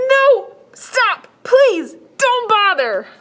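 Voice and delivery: put-on high, slow, firm little girl. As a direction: insist like a stubborn child.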